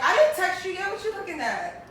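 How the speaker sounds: cheeky teasing; sassy tease. Pretend. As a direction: call him out with a grin. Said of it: "You be like".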